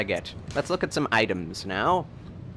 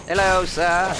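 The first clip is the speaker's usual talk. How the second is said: mock greeting; 7 semitones higher, 6 dB louder than usual. Greeting an enemy mockingly.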